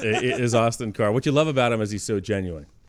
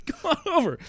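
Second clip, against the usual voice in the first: Austin Carr beckoning. Retold or imitated. Acting Austin calling across lobby.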